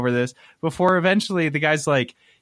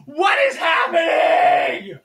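slow, much higher, loud retold cry of disbelief; stunned shout; 4 dB louder, slower than usual. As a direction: yell it as if strangers took over your house. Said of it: "and I'm like".

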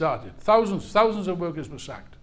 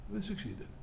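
much softer, almost under the breath; hushed repeat; 14 dB quieter than usual. Real, not acted. Speaker mourns the failed revolution, hushed. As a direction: let it sink in quietly. Repeated, dropped to near silence.

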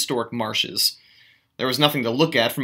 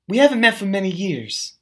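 higher, lightly voiced Daisy, matter-of-fact; 7 semitones higher than usual. A narrator's reading. Daisy to Gatsby, forced matter-of-fact. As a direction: keep it studiously casual. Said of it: "her voice as matter-of-fact as it could ever be".

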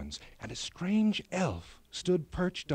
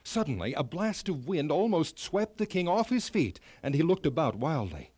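usual speaking voice

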